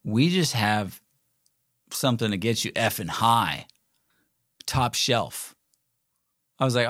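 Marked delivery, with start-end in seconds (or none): 0.0-5.5 lightly voiced stoner, halting with long pauses; stoned shop girl. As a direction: imitate a spaced-out clerk, a little subdued. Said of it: "she's just stoned out of her mind".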